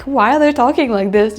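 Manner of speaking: high, loud bewildered protest; mock indignant. Her French side protesting the casual tu, mock indignant. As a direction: play the shocked French rule-keeper. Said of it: "but I'm like".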